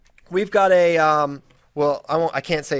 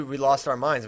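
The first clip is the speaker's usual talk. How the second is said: ordinary delivery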